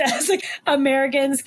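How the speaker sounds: normal talking voice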